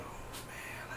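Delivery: barely voiced, overcome whisper; retold whisper. Reviewer re-enacting tears at the episode, whispered. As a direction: whisper it as if about to cry. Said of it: "And I was like".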